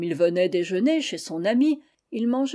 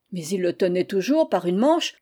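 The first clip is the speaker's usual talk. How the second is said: ordinary delivery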